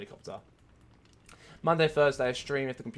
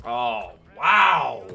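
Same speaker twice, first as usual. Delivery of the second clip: very loud, stretched "wow"; stunned wow; 14 dB louder than usual. Real, not acted. Player reacting to the match, loud stunned exclamation. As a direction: let "wow" ring out loudly.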